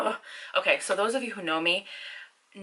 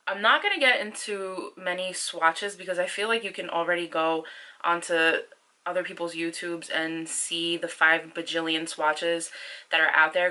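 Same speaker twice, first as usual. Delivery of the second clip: usual speaking voice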